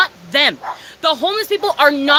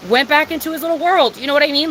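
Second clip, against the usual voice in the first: ordinary delivery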